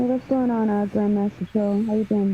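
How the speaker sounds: normal talking voice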